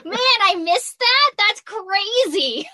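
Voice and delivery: high, loud, drawn-out disbelief; mock surprise. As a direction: play up the shock of missing it. Retelling her reaction to a murder.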